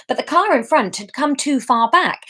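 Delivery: usual speaking voice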